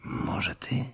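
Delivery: soft whispered aside to a sister; hushed. A narrator's reading. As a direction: whisper it, keep it very quiet. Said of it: "zwrócił się po cichu do siostry". (po cichu, szeptem)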